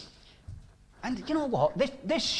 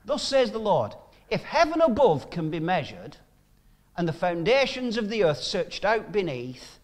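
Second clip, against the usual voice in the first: louder, declaiming the Lord's words; forceful Bible reading; scripture reading; 3 semitones higher, 4 dB louder than usual. Acted. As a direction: proclaim the verses to the congregation. Preacher reads scripture aloud.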